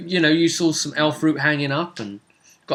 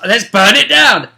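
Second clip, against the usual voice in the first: mock eager arsonist; 7 semitones higher, 9 dB louder than usual. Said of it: "all these ideas of".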